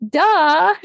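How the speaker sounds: high, loud mock duh; a sheepish, self-mocking duh. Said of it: "And I was like".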